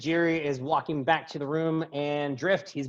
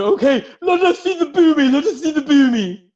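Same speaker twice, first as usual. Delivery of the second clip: very high, loud put-on voice; high-pitched character clamouring from the crowd; eager spectator voice; 11 semitones higher, 8 dB louder than usual. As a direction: squeal eagerly for the show. Voicing an eager audience member.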